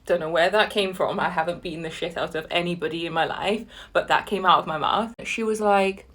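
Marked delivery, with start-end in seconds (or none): none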